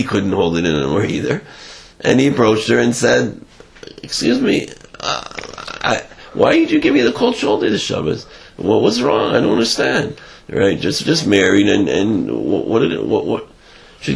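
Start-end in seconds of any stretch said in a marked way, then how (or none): 4.0-10.2 a hint of a high, bewildered young husband; lightly voiced confused groom; mildly baffled husband asking what's wrong; puzzled husband. Husband confronts the cold shoulder.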